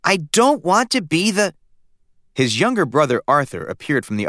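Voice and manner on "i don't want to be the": put-on protesting boy. A narrator's reading. Stanley protests, cut off.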